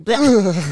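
short imitated noise of disgust; disgusted "bleh". Fan imitates her after the pose, mock disgust. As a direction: blurt a mock-revolted bleh. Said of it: "she's like".

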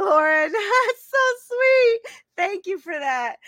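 high, slow, laughing through the words; touched laugh. Real, not acted. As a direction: laugh with real delight at a kind comment. Touched by viewer's comment.

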